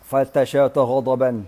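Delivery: ordinary delivery, nothing marked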